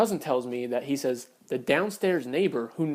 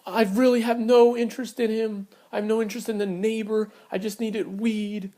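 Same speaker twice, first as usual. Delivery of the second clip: higher mock-tearful pleading; retold weepy protest, half-hearted; imitated crying; 7 semitones higher than usual. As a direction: lightly imitate her tearful excuses. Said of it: "She's crying. She's like".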